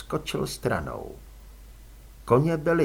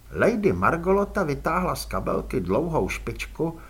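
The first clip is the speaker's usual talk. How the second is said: ordinary delivery, nothing marked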